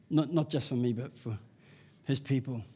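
normal talking voice

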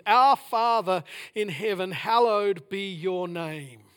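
very slow, weighing each word; slow reverent reading. Shown as a demonstration. Preacher slowly reading the Lord's Prayer opening. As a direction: savour the words of the prayer. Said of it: "What an incredible statement".

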